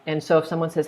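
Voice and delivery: ordinary talk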